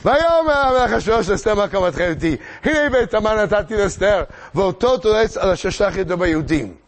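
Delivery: high, loud declaimed scripture; verse recitation. Shown as a demonstration. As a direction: proclaim the king's words in ringing verse. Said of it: "בוא נקרא את הפסוקים". (קריאת פסוקים מוכרזת בקול רם)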